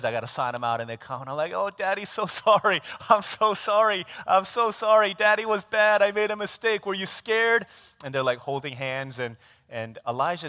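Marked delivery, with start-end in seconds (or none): 1.5-7.7 put-on higher, louder pleading to small children; retold daddy apology, laid on thick; profuse apology. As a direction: plead for the kids' forgiveness. Father apologizing profusely to his kids.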